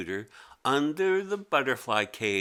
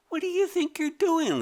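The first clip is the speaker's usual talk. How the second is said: much higher indignant creature voice; the creature protests, voice pitched up high; squeaky creature; 4 dB louder than usual. Acted. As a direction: squeak up in alarm. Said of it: "it said".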